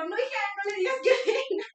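high, loud, panicky protest, retold; acted-out loud, high-pitched alarm; alarmed plea. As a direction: urgently beg the child to stop, hammed-up.

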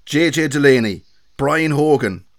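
hammed-up Kilkenny impression; Kilkenny accent. Shown as a demonstration. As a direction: belt out names in a mock Kilkenny accent. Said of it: "here's my best Kilkenny accent".

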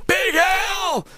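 very high strained gasp; gasping Big Al. Acted. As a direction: force the name out on a high gasp. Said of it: "See, I can't do it".

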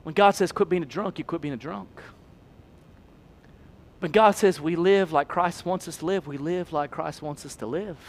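near whisper. Acted. Preacher sinks to near hush.

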